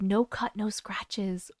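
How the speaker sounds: whispered aside; breathy whispered aside. Real, not acted. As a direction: drop to a whisper.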